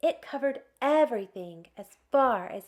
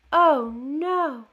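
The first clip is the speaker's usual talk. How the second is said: louder, higher "oh no"; mock dismay; 4 semitones higher, 8 dB louder than usual. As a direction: play it up for the children. Reacting to missing snowball.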